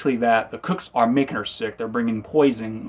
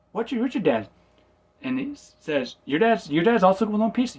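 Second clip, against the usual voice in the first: surprised; 10 semitones higher than usual. Retold or imitated. Luffy surprised about dad.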